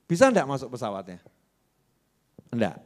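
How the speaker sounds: normal talking voice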